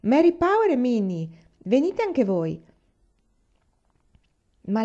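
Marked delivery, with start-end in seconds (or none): 0.0-2.7 raised, higher call that stretches at the end. A narrator's reading. Boy invites two girls at the school door, calling out. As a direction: call across to someone at the door, moderately loud. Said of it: "alle due ragazze sulla porta gridò".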